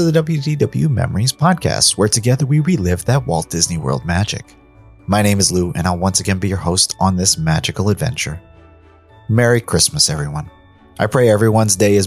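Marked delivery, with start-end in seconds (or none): none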